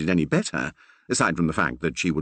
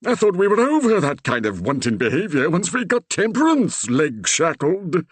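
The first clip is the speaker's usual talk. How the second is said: put-on high, despairing old vicar; groaning Reverend; 10 semitones higher, 4 dB louder than usual. A narrator's reading. The Reverend groaning to Percy in disbelief. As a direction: groan it out in dismay.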